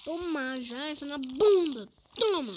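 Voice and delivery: loud gleeful taunting; triumphant shout. Real, not acted. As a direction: yell it as you land the hit. Hitting the mob back.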